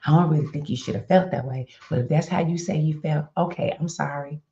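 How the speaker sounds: sorry while blaming her; patronizing half apology; grudging apology. Shown as a demonstration. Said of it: "One more".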